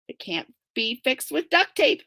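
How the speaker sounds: ordinary delivery, nothing marked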